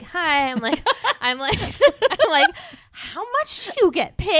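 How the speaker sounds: put-on high, loud kid voice through laughter; hammed-up squeaky-high question, laughing; little kid asking. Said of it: "little Andre, never asked questions".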